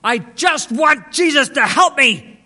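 high, slow, loud anguished cry; imitating a frantic man's wailing plea; desperate shouting. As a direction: cry it out right in the listener's face. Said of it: "he got right in my face".

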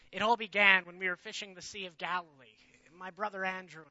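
normal talking voice

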